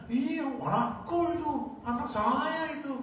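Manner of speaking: very high, drawn-out boastful cry; acted bragging parent, high and stretched; proud parent exclaiming. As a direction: crow with pride, pitched right up.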